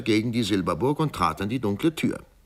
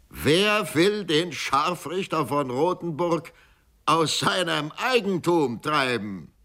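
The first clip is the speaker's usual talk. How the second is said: proclaiming executioner; 8 semitones higher than usual. Acted. Executioner proclaims his claim.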